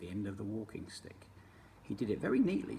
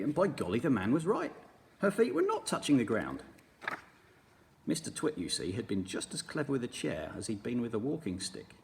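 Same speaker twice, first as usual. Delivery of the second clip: usual speaking voice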